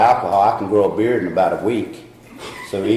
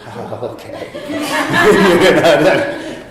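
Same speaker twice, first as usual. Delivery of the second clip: laughing; 8 semitones higher, 4 dB louder, slower than usual. Retold or imitated. Joking about getting shocked.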